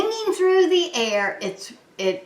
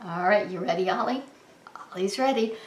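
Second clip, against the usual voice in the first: ordinary delivery, nothing marked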